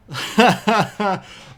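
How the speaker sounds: high, loud laughing fit; real burst of laughter. Real, not acted. Streamer cracks up at kid with the goat, laughing hard.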